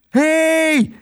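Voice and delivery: very high, drawn-out shout; long, high holler for attention; yelling. A narrator's reading. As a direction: yell after someone far away. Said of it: "schreeuwde Tos".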